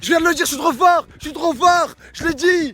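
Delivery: high, loud gloating; triumphant. Real, not acted. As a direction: crow about being right. Fier d'avoir deviné juste. (fier et triomphant)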